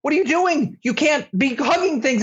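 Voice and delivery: high-pitched panicked dad scolding; hammed-up panic, voice shooting way up; freaking out. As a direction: act out a frantic parent telling off a child. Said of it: "then I freak out".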